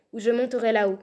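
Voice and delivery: loud, firm threat; shouted threat. A narrator's reading. Bluebeard threatens to climb up to his wife, shouted threat. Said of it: "criait la Barbe Bleue".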